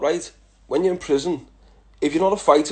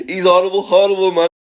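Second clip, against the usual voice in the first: mock outrage; 5 semitones higher, 9 dB louder than usual. Real, not acted. Mock insult of friend.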